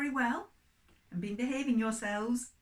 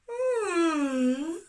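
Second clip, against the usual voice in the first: a high, louder rising yawn; a big waking yawn for Mole; 6 semitones higher, 8 dB louder than usual. Acted. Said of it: "Went Mole".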